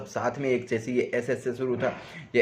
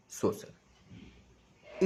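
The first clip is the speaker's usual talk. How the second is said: whispered word; 10 dB quieter, much slower than usual. Shown as a demonstration.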